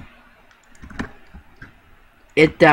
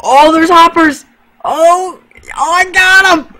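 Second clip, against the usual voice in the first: excited; 8 dB louder than usual. Real, not acted. Excited finding loot in game.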